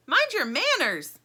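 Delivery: high, slow scolding voice. A narrator's reading. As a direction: scold the rude ball. Shark scolds.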